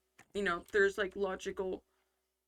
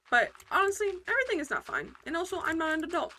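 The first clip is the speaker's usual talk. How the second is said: higher, louder burst of honesty; excited exclamation; 8 semitones higher, 5 dB louder than usual. Real, not acted. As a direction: blurt it out, worked up. Exclaiming after the quote.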